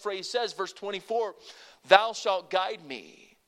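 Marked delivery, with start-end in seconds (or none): 1.9-3.4 slow, weighty scripture line; slow emphatic reading. Shown as a demonstration. Preacher leading the church in a slow emphatic line. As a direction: lay each word down for the congregation to repeat. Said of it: "Can you say it?".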